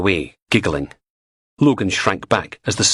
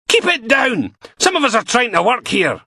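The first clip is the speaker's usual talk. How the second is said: yelling at them; 3 dB louder than usual. Acted. Steele yells from doorway.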